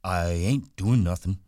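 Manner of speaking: a big gentle man protesting innocence; Lenny. A narrator's reading. Lenny voiced in story.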